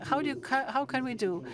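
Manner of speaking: ordinary talk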